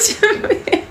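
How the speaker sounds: breathless laughing high bring it. Real, not acted. Laughing as she asks her mother to bring it, high laugh.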